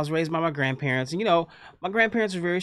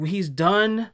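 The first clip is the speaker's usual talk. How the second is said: ordinary talk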